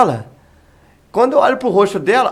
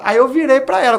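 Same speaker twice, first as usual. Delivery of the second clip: ordinary talk, nothing marked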